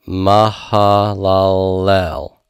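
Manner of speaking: "mahalalel" drawn out very slowly, ma-ha-la-LEL; Hebrew pronunciation. Shown as a demonstration. Teacher showing how the Hebrew name is pronounced, very slow syllables. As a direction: sound out "mahalalel" syllable by syllable, stretching each one. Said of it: "So this is pronounced".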